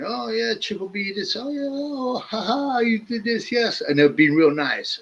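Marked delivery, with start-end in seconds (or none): 0.0-3.8 sweet talking. Retold or imitated. Dealer sweet-talking his girlfriend on the phone, high and cooing. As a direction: coo at her in a high voice. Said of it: "They'll be going".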